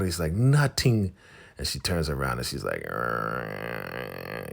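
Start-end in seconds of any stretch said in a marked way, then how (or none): 0.3-1.1 high, loud mock brush-off; exasperated denial. Daikon brushing off Mava's question, exasperated mock denial. 2.9-4.5 drawn-out mocking "arr" sound; annoying little noise. Mava turning away from Daikon, mocked annoying noise. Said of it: "with that little noise".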